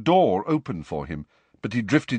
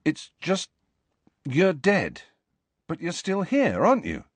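halting, higher-pitched questioning; awkward; 8 semitones higher than usual. A narrator's reading. Harry asks the ghost about being dead, awkwardly. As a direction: feel your way in with pauses. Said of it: "finding this more awkward".

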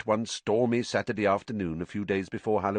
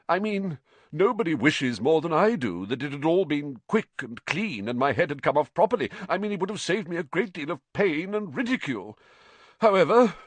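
higher, fast wounded complaint; aggrieved rant; 8 semitones higher, 4 dB louder than usual. A narrator's reading. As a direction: pour out the grievance.

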